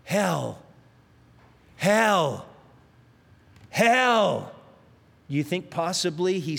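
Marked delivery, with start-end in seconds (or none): 0.0-4.5 each hell louder, rising into a wail; dying infidel crying out in terror, building loud; crying out in despair. Retold or imitated. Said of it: "he just cried out three words".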